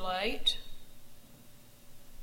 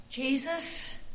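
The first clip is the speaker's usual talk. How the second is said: gasping; 5 semitones higher, 4 dB louder than usual. A narrator's reading. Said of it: "he gasped".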